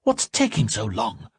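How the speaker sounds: high, impatient complaint; impatient mistress. A narrator's reading. Salmataya waiting for her tea, high impatient voice. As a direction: fret at the delay.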